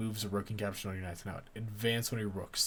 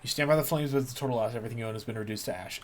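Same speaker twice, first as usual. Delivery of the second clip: ordinary delivery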